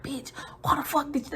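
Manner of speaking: hissed scolding; scolding friend in a whisper; angry whisper. Retold or imitated. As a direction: whisper it furiously. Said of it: "we whispering".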